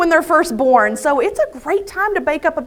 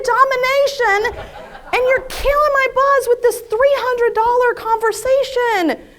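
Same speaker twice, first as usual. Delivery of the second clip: re-enacted exasperated yell, high and drawn out; fed-up outburst; 9 semitones higher, 4 dB louder than usual. Wife to cautious husband, acting out being fed up. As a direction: act out snapping at a spouse, pitched up. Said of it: "I'd had enough".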